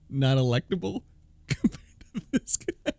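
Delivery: words broken up by laughter; real laughter at the absurdity; laughing. Real, not acted. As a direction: laugh through the punchline. Laughing mid-rant.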